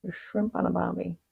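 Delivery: soft, strained Aussie impression; failed Australian accent. Shown as a demonstration. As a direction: give up halfway through an Aussie accent. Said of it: "I can't do it".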